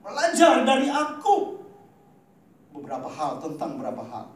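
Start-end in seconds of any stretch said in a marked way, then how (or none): 0.0-1.6 raised and ringing; higher and louder, proclaiming it; the same verse cried out to the congregation; proclaimed quote. Shown as a demonstration. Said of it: "Ada pernyataan Tuhan".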